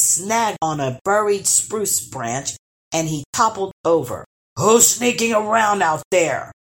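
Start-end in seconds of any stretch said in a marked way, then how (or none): 4.6-6.5 a fairly loud troll bellow. A narrator's reading. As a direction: call out into the dark like a troll. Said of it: "bellowed the first troll".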